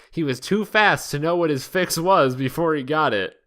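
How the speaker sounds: ordinary delivery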